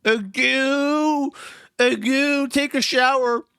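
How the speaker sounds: put-on high, cooing baby talk with a pacifier. Shown as a demonstration. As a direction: goo like a baby sucking a pacifier. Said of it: "You gotta say it in a baby voice with a pacifier".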